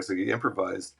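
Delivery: ordinary delivery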